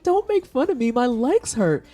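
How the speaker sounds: mock whiny plea. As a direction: whine at your teasing buddy. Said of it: "And I'm like".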